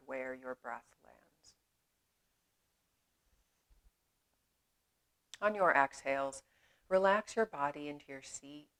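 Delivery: unhurried meditation voice with long pauses; measured, spacious yoga-teacher delivery; guided meditation. Acted. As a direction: guide them gently inward, leaving space after each phrase.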